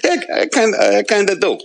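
put-on high-pitched comic Jersey mob-boss accent; De Niro. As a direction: play a Jersey mobster grudgingly admitting it. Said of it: "You did that Jersey accent really well there".

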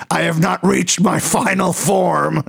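drawn-out demonic boast, hammed up. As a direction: imitate a ranting woman turned demon. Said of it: "why I call it the demon voice".

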